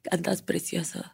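imitating her friend's voice, softly; soft retold compliment in a friend's voice; Mónica. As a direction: do your friend's hushed little compliment. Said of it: "Ya sabes, con su voz de".

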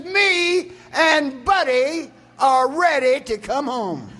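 put-on high, slow, ecstatic old woman crying out to heaven. Grandmother rising in prayer, announcing to heaven, high and slow. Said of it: "her voice starts to raise".

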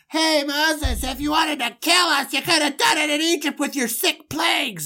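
high, whiny and sarcastic; a complaining traveller confronting Moses, high and whiny; griping Israelite. Acted. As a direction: moan at Moses with mock politeness. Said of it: "the first gripe is".

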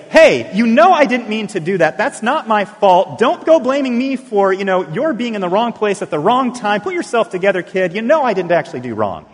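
loud, high, defensive mock outburst; hammed-up angry parent shifting the blame; indignant scolding. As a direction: snap at a kid as if it's all their fault. Acted parent blaming a hurt toddler.

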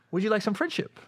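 pushy tout. Retold or imitated. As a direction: offer it sweetly like a hustler. Club tout voiced in story.

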